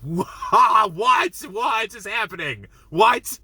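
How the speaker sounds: high shocked shouts, dragged slow; can't believe what just happened; stunned disbelief. Retold or imitated. Reviewer reliving the restaurant scene, shocked high shouts. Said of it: "And I was like".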